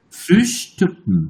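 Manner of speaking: Frühstücken drawn out, ü and final -ken clearly spoken; slow German demo. Shown as a demonstration. Teacher showing the correct German sound to students, slow. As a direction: model Frühstücken very slowly. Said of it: "আমি যদি জার্মানে শুনি".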